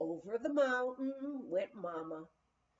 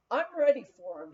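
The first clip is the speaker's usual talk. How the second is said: grinning and louder, eager; 2 semitones higher, 8 dB louder, faster than usual. Retold or imitated. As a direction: say it with a big grin. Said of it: "he grinned".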